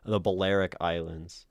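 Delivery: ordinary delivery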